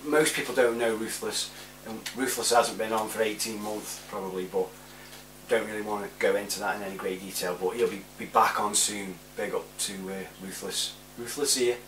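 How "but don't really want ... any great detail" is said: ordinary talk, nothing marked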